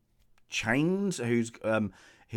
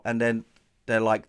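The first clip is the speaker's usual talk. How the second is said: ordinary talk, nothing marked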